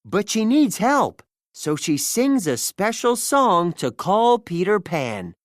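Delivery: plain reading voice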